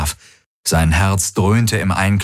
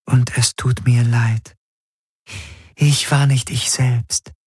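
a touch of sorrow; 4 semitones higher than usual. A narrator's reading. The figure apologizes to Garland.

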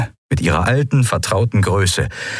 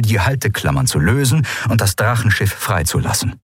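usual speaking voice

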